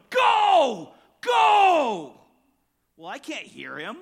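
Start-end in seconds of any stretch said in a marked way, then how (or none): 0.1-2.1 loud high shout from the third base coach; coach yelling go. Retold or imitated. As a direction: yell it across the field to the runner. Coach yelling runner home.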